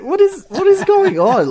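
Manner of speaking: mock outrage at a ludicrous scene; baffled disbelief. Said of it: "I'm like".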